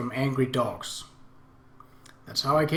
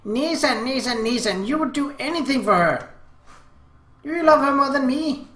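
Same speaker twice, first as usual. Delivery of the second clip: high, loud, aggrieved little-sister voice; upset younger sibling's high protest; tearful little sister; 12 semitones higher, 6 dB louder than usual. A narrator's reading. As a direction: plead jealously, pitched well up. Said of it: "I saw tears in her eyes".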